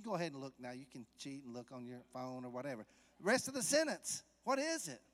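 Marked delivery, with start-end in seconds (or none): 3.2-5.0 loud, high, driving sermon voice; fervent preaching. Acted. Preacher driving home the point to the church. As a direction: press the point home hard.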